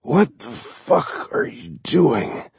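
slow, high, slurred wounded man. Acted. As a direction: drag the words out, slurring. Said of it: "spoke in a slurred voice".

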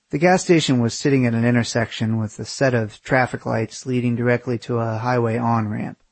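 plain reading voice

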